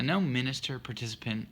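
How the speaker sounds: ordinary talk, nothing marked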